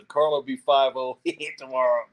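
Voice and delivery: ordinary delivery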